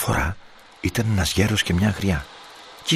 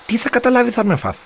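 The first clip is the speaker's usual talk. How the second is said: high, lisping voice of a tiny fish; little pleading fish; 8 semitones higher, 4 dB louder than usual. A narrator's reading. Little fish begs the boy to let it grow, high lisping plea.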